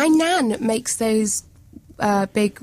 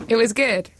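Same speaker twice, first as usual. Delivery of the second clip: usual speaking voice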